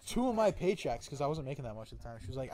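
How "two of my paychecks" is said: high, loud and slow, word by word; imitating his own heated objection; retold indignant protest. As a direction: hammer out the refusal in mock outrage. Retold quarrel with the cop.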